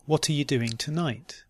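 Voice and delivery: "What are you doing tonight" run together quickly. Shown as a demonstration. Teacher repeating the practice question, quickly.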